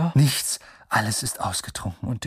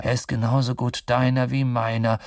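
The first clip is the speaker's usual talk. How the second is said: plain reading voice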